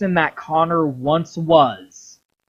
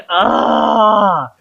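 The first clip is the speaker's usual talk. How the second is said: pained groan; 5 semitones higher, 5 dB louder than usual. Retold or imitated. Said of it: "he's like".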